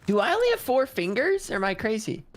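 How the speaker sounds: surprised. Real, not acted. Sudden confused question mid-game.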